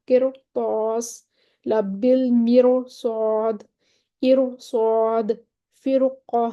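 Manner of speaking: قرطاس، لبل مرصاد، ارصاد said very slowly, each ر heavy; slow tajweed recitation. Shown as a demonstration. Teacher reciting the four words as a list, slow with heavy ر. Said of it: "یہ چار لفظ ہیں".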